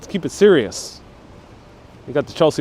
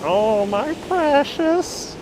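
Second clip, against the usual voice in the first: high, soft, slow creepy hiss; Gollum; 10 semitones higher, 8 dB quieter, much slower than usual. Retold or imitated. Chat impression round, high soft Gollum voice. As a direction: coo it up high, covetous.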